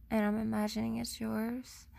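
ordinary delivery

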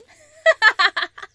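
loud high gleeful laugh; wild laugh. Acted. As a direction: cackle at the chase. Predator laughing at chase.

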